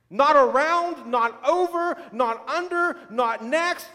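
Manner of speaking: preacher building to the climax; rising preaching climax. Acted. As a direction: hammer each not, land on through.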